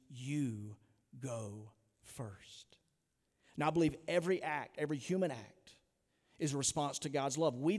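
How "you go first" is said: breathy hushed whisper, almost no voice. Real, not acted. Preacher whispering the challenge to the congregation. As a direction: lean in and breathe the words like a secret.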